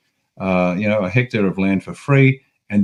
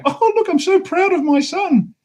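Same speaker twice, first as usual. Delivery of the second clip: much higher, slower mock-proud mother voice; slower than usual. Mocking the idiot mothers who brag about sons, high and slow. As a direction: parody a gushing mother, pitched way up. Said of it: "these idiot mothers going".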